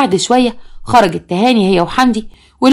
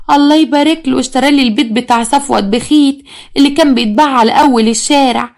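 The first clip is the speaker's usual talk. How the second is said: higher, put-on simpering imitation; mock someone showing off coyly, pitched up; coquettish mimicry; 4 semitones higher than usual. Daughter imitates stepmother boasting of a house, mock coquettish. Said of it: "لما قالت وهي بتدلع".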